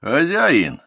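high, slow call across the dark; loud voice from afar. A narrator's reading. Stranger by the car hails the owner, calling loudly. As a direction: hail the owner from a distance. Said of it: "раздался от машины громкий голос".

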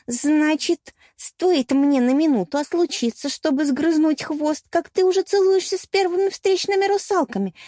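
high, accusing jealous tirade; торжествующий крик. A narrator's reading. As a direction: cry out in triumph, pitch climbing to the end. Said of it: "торжествующе вскричала та". (торжествующий ревнивый крик)

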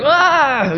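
long, high "aah" of acted rage; enraged yell. Preacher voicing Peter lunging with the sword, mock enraged cry. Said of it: "He's just filled with rage".

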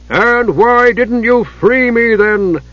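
high, loud, slow demanding giant; angry giant. A narrator's reading.

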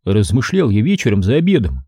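ordinary delivery

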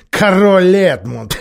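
mocking laugh around the name, slow and loud; scornful snort. A narrator's reading. Miraz mocking the talk of King Edmund, scornful laughing snort. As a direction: snort with contempt. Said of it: "фыркнул Мирас".

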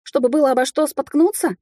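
high, sarcastic retort; exasperated rhetorical question; snapping back. A narrator's reading. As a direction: throw it back irritably. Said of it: "огрызнулась я".

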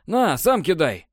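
huffy retort. Acted. Partner hands over grenade.

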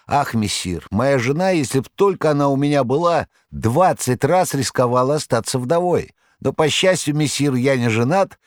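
high, fussy tomcat voice pleading his case; a boastful cat protesting his heroics, high; cat Behemoth. A narrator's reading.

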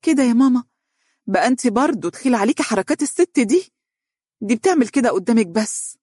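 high-pitched, wounded plea; sad reproach. A narrator's reading. Daughter sadly reproaching her mother for believing the other woman. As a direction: protest as if hurt at being disbelieved. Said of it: "وقالت بحزن".

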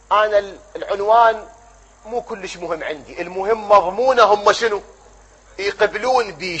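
usual speaking voice

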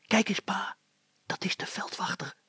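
urgent hushed hiss. Acted. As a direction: point him out in a hiss. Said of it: "siste Hannes".

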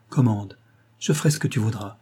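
ordinary delivery, nothing marked

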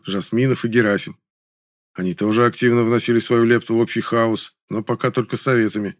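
ordinary delivery, nothing marked

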